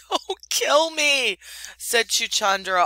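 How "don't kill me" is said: much higher, slow, pleading near tears; almost weeping. A narrator's reading. The muskrat Chuchundra begs Rikki Tikki to spare him, almost weeping. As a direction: beg for your life, voice close to breaking. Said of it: "almost weeping".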